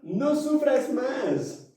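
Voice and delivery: high, slow dramatic pitch; over eager infomercial. Pretend. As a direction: promise relief like a TV ad. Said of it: "Me sentí como infomercial".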